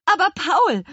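high, loud alarmed call; startled cry. Acted. Wife calling after husband speeding off, high startled cry. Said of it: "rief Frau Kuchenbrand erschrocken".

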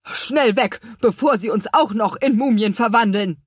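loud alarmed call to flee; urgent shout. A narrator's reading. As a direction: yell to the crew to get away. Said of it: "rief der Chefobstpflücker". (laut und panisch gerufen)

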